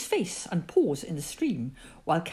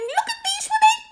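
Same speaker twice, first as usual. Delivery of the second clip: very high, quick squeal; excited squeak; 4 dB louder than usual. A narrator's reading. Roo answers from whatever pool, squealing proudly. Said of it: "squeaked Roo".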